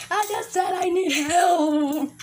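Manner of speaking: high, drawn-out plea for help; slow, high-pitched begging; pleading wail. Acted. Son begs while being scolded.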